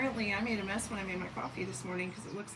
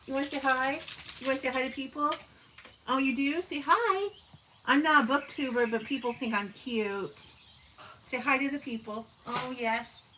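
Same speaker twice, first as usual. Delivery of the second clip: coaxing the dog; 5 semitones higher, 5 dB louder than usual. Real, not acted. Coaxing dog to greet chat.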